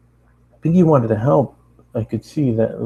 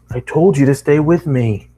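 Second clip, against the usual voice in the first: serious; 5 semitones higher, 5 dB louder than usual. Retold or imitated. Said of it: "I said with seriousness".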